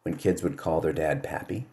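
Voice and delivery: ordinary talk, nothing marked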